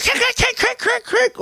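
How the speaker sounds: high crunching "crick" noises; car crunch imitation. Imitating the sound of his car hitting the cab, high crunch noises. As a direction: mimic metal crunching in a crash. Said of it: "the sound the car made".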